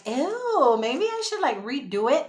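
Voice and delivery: high, drawn-out ew, then easing down; mock self-doubt. Vlogger replaying her reaction to her playback, mock squeamish. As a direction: squeamish second thoughts, acted out. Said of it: "'cause I'm like".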